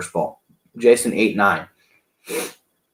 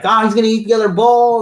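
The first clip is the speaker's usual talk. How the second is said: much higher, louder onlooker's cry; imitated crowd excitement; 11 semitones higher, 6 dB louder than usual. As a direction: mimic the crowd egging him on. Acting out the cheering onlookers.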